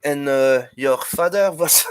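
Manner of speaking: Monty Python style fake French accent; mock French accent. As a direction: taunt in an outrageous French accent. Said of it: "go see the Monty Pythons".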